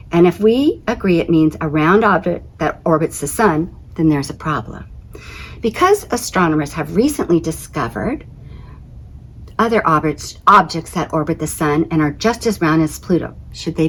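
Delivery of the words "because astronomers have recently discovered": usual speaking voice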